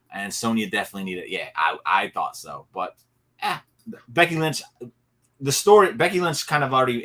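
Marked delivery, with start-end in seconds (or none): none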